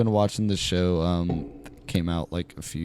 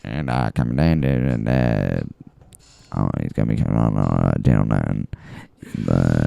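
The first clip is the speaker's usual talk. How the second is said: deep announcer voice; 9 semitones lower, 5 dB louder than usual. Pretend. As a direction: drop deep like a radio host. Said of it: "nineteen fifties radio announcer".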